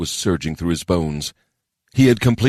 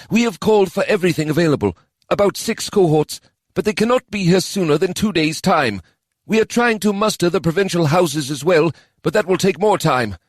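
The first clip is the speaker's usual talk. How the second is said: a hint of a higher, heavy elf; lightly voiced elf officer; 12 semitones higher than usual. A narrator's reading. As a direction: report the bad news plainly. Kadan voiced in story.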